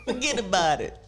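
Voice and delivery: lightly voiced Sopranos-style New Jersey Italian; mock New Jersey Italian. Host rehearses a New Jersey Italian accent for guests. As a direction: toss off Forget about it like a mobster from The Sopranos. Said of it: "work on my New Jersey ... Italian accent".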